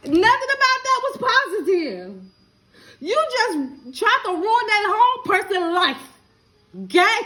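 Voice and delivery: high, loud, voice close to crying; tearful loud scolding. Real, not acted. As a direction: scold them with tears rising. Scolding someone on live video.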